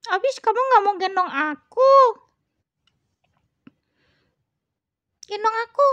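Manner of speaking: high, loud cat voice; demanding cat. Pretend. Cat demands Cici carry it, high insistent cat voice.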